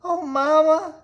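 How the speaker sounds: high, loud and drawn out; tearful little witch. Acted. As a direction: cry out to mother. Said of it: "said Maggie".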